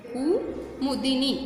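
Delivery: Kumudini drawn out slowly, syllable by syllable; slow model word. Shown as a demonstration. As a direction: say Kumudini slowly so the kids can copy each syllable. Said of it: "teacher repeats word slowly for pupils". (कुमुदिनी को धीरे-धीरे, एक-एक अक्षर बोलकर)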